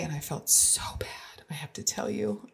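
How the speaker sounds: hushed, confiding whisper. Real, not acted. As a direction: lean in and whisper it like a secret. Confiding something she feels bad about.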